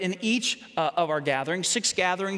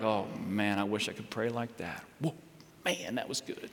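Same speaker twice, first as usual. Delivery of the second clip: very low, soft, acted admiration; mock being deeply impressed, voice dropped low; hushed awe; 12 semitones lower, 8 dB quieter than usual. Awed listener after someone's prayer.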